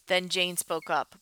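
ordinary delivery

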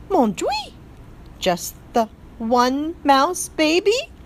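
high, slow French gasp of dismay; French accent, shocked and dramatic; dismayed French mother. A narrator's reading. French mother mouse told only one baby lived, dismayed.

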